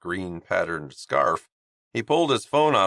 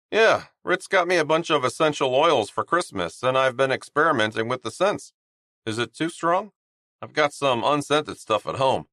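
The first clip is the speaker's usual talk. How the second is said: put-on higher, bright young teammate voice; chirpy guy pitching his skincare line; upbeat higher voice of a friendly hockey player; cheerful Honeybun; 6 semitones higher than usual. Honeybun speaking.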